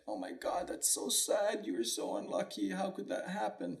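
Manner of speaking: soft, half-whispered mock pity; imitating sorrowful neighbors. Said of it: "they're like".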